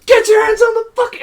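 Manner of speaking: very high, loud barked command; imitated police shout. Police to the host at gunpoint, imitated shout. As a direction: yell it like an officer giving orders. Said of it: "and I hear".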